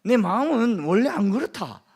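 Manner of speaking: loud, emphatic Gyeongsang protest; Gyeongsang dialect. Acted. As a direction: insist loudly with swinging Gyeongsang intonation. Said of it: "우리 경상도 사람들이 하는 말이". (경상도 억양으로 크게 항변하듯)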